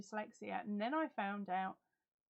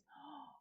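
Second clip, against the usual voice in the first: surprised gasp; 4 semitones higher, 13 dB quieter than usual. Real, not acted. Hears Kath has untold stories.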